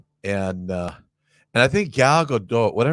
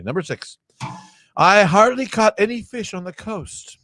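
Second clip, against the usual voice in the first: ordinary delivery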